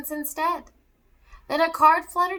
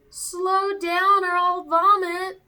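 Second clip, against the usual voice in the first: slow, sung-out louder holler; 7 semitones higher, 5 dB louder, much slower than usual. Acted. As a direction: wail it sing-song as the sleigh speeds. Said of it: "causing Murray to holler".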